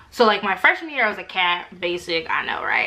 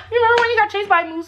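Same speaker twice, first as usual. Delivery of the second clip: high, fast mocking tease; family teasing her, high and fast; 12 semitones higher, 4 dB louder, much faster than usual. Family teasing her about moose.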